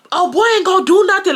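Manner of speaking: high, quick imitation of taunting boys; mocking, trying to be funny. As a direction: jeer like a cocky teenager. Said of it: "trying to be funny".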